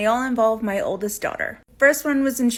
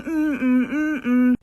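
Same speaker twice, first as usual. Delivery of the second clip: soothing hum; 3 semitones higher, much slower than usual. Retold or imitated. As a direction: hum it gently, like rocking a baby to sleep. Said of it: "how she would soothe me, is by rocking back and forth, going".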